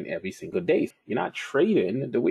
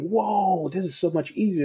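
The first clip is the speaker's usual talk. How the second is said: excited discovery; 10 semitones higher than usual. Retold or imitated. Retelling discovering bigger time frames.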